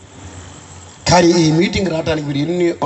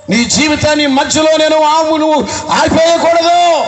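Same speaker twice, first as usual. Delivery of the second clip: slow, ringing pulpit blessing; fervent blessing; 9 semitones higher than usual. Acted. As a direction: declare life over them. Preaching at full force.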